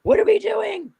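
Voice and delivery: put-on high, whiny kids asking where we're going; antsy family. Family pestering her to leave, whiny and high. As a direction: mimic the family's impatient pestering. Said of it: "they're like".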